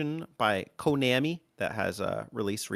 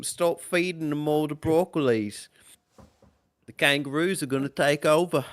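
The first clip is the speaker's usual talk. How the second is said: mock Australian; 3 semitones higher than usual. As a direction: keep the Aussie bit going. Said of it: "I'll be Australian".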